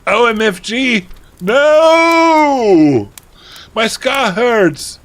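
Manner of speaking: very high, loud wail with a long drawn-out "no"; hammed-up scream. A narrator's reading. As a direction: scream it in melodramatic agony. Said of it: "he started to scream".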